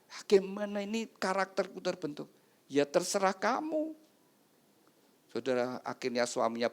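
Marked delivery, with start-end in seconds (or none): 0.1-3.9 helpless protest. Acted. Husband protests he cannot change.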